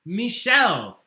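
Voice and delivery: loud, bright announcing of the answer; excited reveal. Real, not acted. Host reveals the right answer to players, excited. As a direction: call out the winner's name with a burst.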